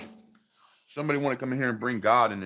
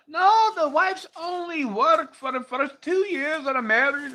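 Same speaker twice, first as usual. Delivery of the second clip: mocking high voice; 13 semitones higher, slower than usual. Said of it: "And then they said".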